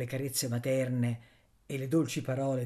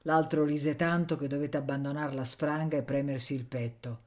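plain reading voice

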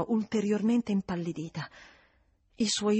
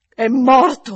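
much louder cry on "morto"; anguished revelation; 5 semitones higher, 13 dB louder, slower than usual. Acted. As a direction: force the dreadful word out. Housekeeper reveals the death.

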